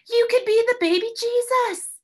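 very high, loud eager idea; excited Bilby. A narrator's reading. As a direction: share the brainstorm.

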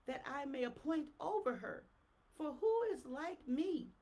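loud, slow, rising proclamation; fiery preaching. Acted. Preacher roaring Jeremiah's prophecy to the church, loud and slow. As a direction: thunder the prophecy at them. Reads scripture in preaching cadence.

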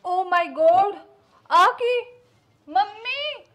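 loud, high cry of dismay; shocked shout. Acted. Bride calling to her mother-in-law, loud and shocked. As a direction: yell out in horror at what she sees. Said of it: "ਹੌਲੀ ਬੋਲਿਆ ਕਰ".

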